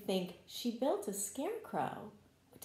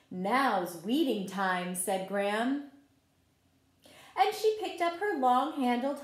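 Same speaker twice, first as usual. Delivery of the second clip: story narration; 5 dB louder than usual. A narrator's reading. Teacher reading a garden story to children, gentle read-aloud. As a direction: tell the story like a picture book at circle time. Reads picture book to children.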